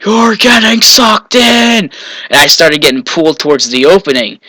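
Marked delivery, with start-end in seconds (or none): 0.1-1.9 high, drawn-out panicked cry; retold scream. Friend warns swimmer near the open dam, mock scream. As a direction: imitate a friend screaming a warning, high and slow. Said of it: "my friend just screams at me".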